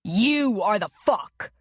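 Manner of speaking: nasal retort through a broken nose; returned nasally. A narrator's reading. Connor snapping back at Dylan with a broken nose, nasal retort. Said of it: "Connor returned nasally".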